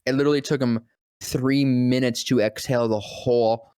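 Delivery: ordinary talk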